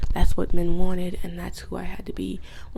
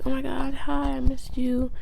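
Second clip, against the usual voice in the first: higher, put-on gushy hello; mock sweet greeting; 6 semitones higher than usual. Wife greeting the husband she returned to, mock sweetness. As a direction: fake a delighted reunion.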